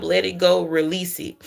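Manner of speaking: ordinary delivery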